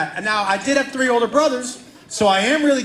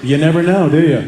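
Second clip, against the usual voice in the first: sly brother; 5 semitones lower, 7 dB louder than usual. Retold or imitated.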